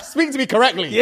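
laughing mock scold. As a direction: tease back through laughter.

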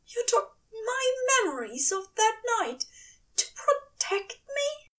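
high angel voice fading to a whisper; disbelief, trailing into a whisper; incredulous angel. Acted. Aziraphale incredulous.

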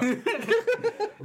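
strange high-pitched laugh burst; odd high laugh. Real, not acted. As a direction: let out a weird squeaky laugh. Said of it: "What kind of a laugh was that".

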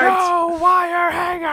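high, loud, drawn-out shriek; mock enraged scream. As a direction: scream it as a melodramatic furious mother. Quoting enraged movie mother.

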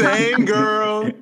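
high laughing repeat. Real, not acted. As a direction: giggle it out.

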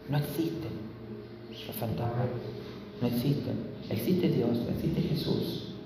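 very soft, low and grave; hushed assurance. Real, not acted. Preacher telling the congregation ghosts do not exist, hushed assurance. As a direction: drop right down, almost to a whisper.

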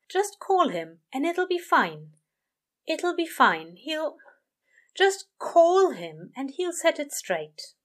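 an anxious angel urging himself to dial; nervous angel talking himself up. A narrator's reading. Aziraphale coaxing himself nervously.

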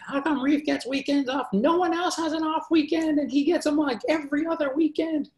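high-pitched mock complaining voice; exaggerated high whine of complaint; whiny complainers. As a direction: imitate griping coworkers in a squeaky falsetto. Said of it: "people would complain".

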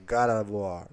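God of War with an American accent. Shown as a demonstration. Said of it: "O sotaquezinho americano".

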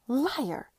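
high, loud venomous accusation. A narrator's reading. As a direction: spit it out with venom. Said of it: "Clara said with vi-, with venom".

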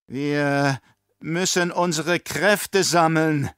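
slow, gasping plea. Acted. Baron pleads with the emperor, gasping. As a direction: gasp it out in panic. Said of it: "keuchte der Baron".

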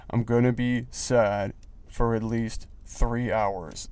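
low, slow and subdued, dragging the words out; mock misery. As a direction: sulk through it slowly and quietly. Contrasts own misery with cheerful friends.

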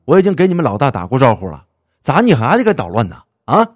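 loud put-on gangster boss; roughened, forceful intimidation; rough tough-guy voice. A narrator's reading. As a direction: clear the throat, then throw your weight around loudly. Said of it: "放粗嗓子说".

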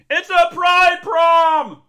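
slow, high full shout; imitated yell across a big hall; shouting down to onlookers. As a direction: holler it drawn out word by word. Imitates people yelling down stairs.

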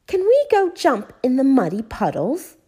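put-on high, loud eager child's question; Peppa, excited. A narrator's reading. Peppa asking at the new house, excited. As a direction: beg to go out and play. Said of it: "Peppa was excited about the new house".

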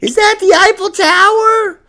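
high, slow mock-amazed tourist squeal; gawking tourist. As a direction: play a wide-eyed tourist staring up.

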